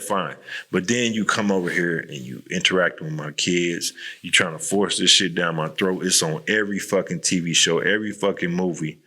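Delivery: normal talking voice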